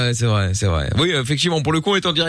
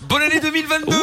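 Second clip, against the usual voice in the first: voix de fête; 11 semitones higher, faster than usual. Pretend. Souhaite la nouvelle année en plaisantant.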